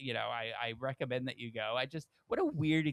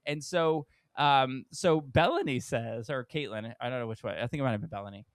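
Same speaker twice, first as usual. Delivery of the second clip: ordinary delivery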